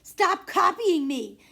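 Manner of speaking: high, slow, laughing yell; a kid's laughing protest; loud shout. Acted. Sibling shouting, copied back.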